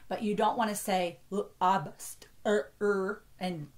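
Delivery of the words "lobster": "lobster" broken up into separate sounds; disjointed. Shown as a demonstration. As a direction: chop "lobster" apart, l - ob - st - er. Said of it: "make it disjointed like that".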